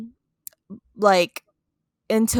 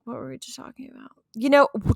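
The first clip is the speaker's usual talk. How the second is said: talking through a sore jaw; stiff, sore jaw slowing the words. Real, not acted. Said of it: "talking is hard today".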